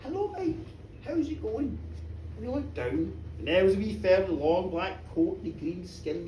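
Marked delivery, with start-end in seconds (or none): 0.0-1.8 wee voice. Acted. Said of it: "the wee voice said".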